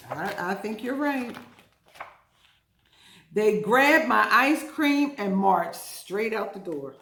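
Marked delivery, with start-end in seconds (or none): 3.3-6.9 dramatic reading. A narrator's reading. Story reading.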